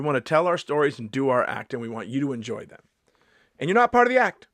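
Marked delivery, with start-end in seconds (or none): 3.6-4.5 high, loud outburst; indignant shout. Pretend. Comedian telling audience they're not the act, indignant shout. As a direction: shout it at them, pitch jumping up.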